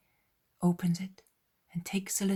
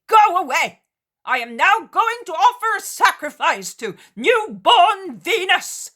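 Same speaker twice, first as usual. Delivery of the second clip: high, very loud furious screaming; raging curse; 11 semitones higher, 14 dB louder than usual. Acted. Cretan screaming curses at the Israelites. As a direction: spit curses at them at full volume. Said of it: "the man shouts louder".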